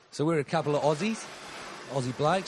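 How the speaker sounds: hammed-up Aussie podcast host; put-on Australian accent. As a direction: play a laid-back Australian bloke introducing the show.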